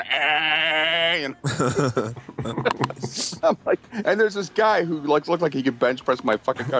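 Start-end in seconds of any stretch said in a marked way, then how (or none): 0.0-1.2 high, flat electronic A; imitated machine voice. Mimicking the ghost machine's flat voice saying A. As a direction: copy the ghost box's single tone. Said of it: "the machine's, like, going".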